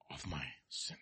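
whisper. Real, not acted. Quoted psalm fades to whisper.

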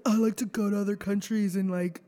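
lightly voiced rich kid, slowed down.

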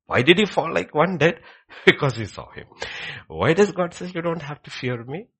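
high, loud rhetorical questioning; full-force preaching. Real, not acted. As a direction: press the questions loudly on the congregation.

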